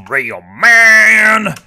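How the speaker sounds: loud, drawn-out bellow; slow hammed-up shout; mock macho shout. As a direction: roar it like a cartoon tough guy. Mocking British guy.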